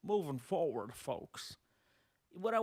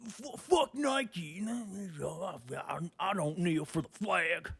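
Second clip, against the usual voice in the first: mock protester; much slower than usual. Mocking Nike burner.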